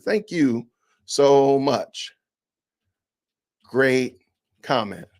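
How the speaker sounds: slow, mock-sweet thank you; sarcastic thanks, drawn out slowly; mock polite reply. Shown as a demonstration. Vlogger demoing a dismissive reply, slow and mock-sweet. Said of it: "I'm gonna do it like this".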